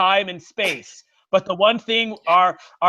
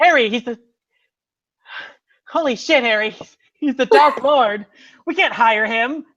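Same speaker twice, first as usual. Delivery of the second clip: alarmed protest; 5 semitones higher, 5 dB louder than usual. Acted. Acted alarm at hiring Dark Lord.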